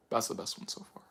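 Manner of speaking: breathy whispered echo; whispered repeat. Retold or imitated. Praising Gamma Gamer's choice, echoed in a whisper. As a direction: whisper it back to yourself. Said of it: "You're like".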